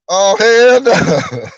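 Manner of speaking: very slow, loud, stretched hell no; drawn-out hell no. Retold or imitated. A viewer reacting to the crying, slow and stretched. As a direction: stretch it out in disbelief.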